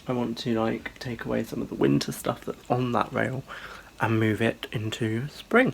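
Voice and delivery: normal talking voice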